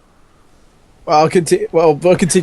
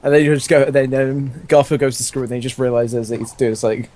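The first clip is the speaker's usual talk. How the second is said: normal talking voice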